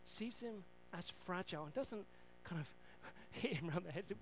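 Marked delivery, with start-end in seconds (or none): none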